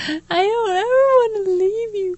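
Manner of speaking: high child's voice, imitated crying; imitating a crying child. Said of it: "he just bawled his little eyes out".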